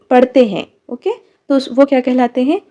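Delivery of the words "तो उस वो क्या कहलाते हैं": ordinary delivery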